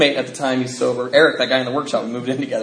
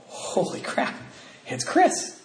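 sudden surprise; 6 semitones higher, 6 dB quieter, much slower than usual. Real, not acted. Suddenly spots Chris in window.

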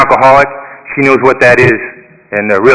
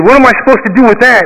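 pitched way up, a retold indignant cry; shocked outburst; 10 semitones higher, faster than usual. To his sponsor on hearing about his wife, indignant shock. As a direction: throw it back, stunned and offended.

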